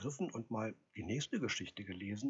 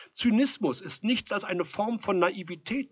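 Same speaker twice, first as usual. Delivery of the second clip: raised, higher voice of reproof; lecturing loudly; 10 semitones higher, 9 dB louder than usual. A narrator's reading. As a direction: lecture him through the door. Visitor lectures through the door.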